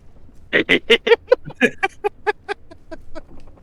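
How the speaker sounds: long, high, helpless laughter. Real, not acted. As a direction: crack up at the mock threat. Laughing at mock threat.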